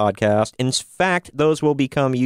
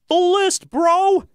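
high-pitched bro; slower than usual. Acted. Catchphrase after the list.

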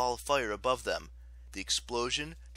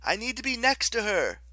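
high, raised-voice urging; urgent plea; 12 semitones higher, 7 dB louder than usual. A narrator's reading. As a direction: call out urgently over the noise. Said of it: "Piper urged".

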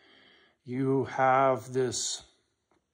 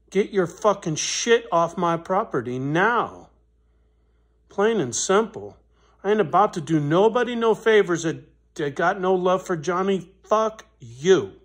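loud cursing threat, pitched up; angry threat; 4 semitones higher, 6 dB louder than usual. Retold or imitated. Man retelling telling off the neighbor, loud and angry. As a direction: snap it at him, heated and loud. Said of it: "I go".

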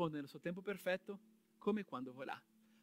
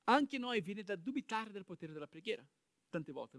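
normal talking voice

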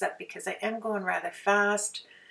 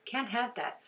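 ordinary talk, nothing marked

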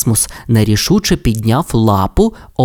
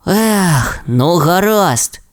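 heavy sigh; 6 semitones higher, much slower than usual. A narrator's reading. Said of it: "Расмус важко зітхнув".

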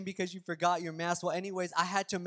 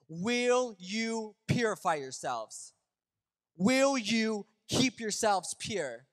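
slow challenge; 5 semitones higher, slower than usual. Real, not acted. Key challenge to the church.

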